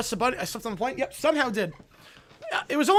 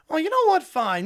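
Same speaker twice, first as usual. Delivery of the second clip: loud, sulky give-up; huffy villain; 6 semitones higher, 5 dB louder than usual. Acted. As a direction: concede in a mock huff. Villain sulks again.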